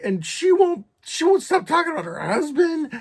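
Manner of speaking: mock-tearful, high, loud complaint; retold crying rant. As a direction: wail it like an exasperated crybaby. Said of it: "But as I'm crying".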